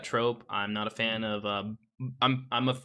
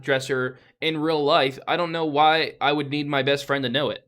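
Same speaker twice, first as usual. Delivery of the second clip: ordinary talk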